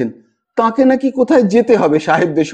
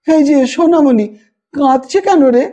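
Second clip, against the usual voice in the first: fond concern; 6 semitones higher than usual. A narrator's reading. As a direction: comfort the little one as you enter. Tilu enters to comfort child. (আদর করে শিশুকে জিজ্ঞেস)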